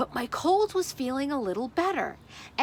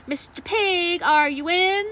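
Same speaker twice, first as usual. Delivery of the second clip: calling out; 5 semitones higher, 5 dB louder than usual. Acted. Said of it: "I called".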